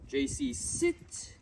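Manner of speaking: slow, high stretched-out command; drawn-out command. Real, not acted. Trainer cueing her dog to sit, slow high command. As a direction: draw each word out for the dog.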